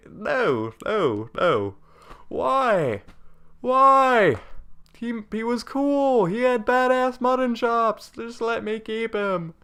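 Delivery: high, whiny mock wail; hammed-up lament, pleading; mock grief. Mourning favourite dead soldier.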